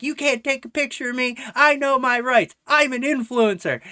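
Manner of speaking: high, loud mock indignation; hammed-up shrieky protest; screaming influencer Karen. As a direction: imitate an entitled Karen yelling. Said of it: "this stupid bitch scream".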